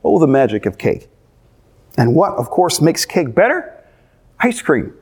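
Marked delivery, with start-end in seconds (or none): none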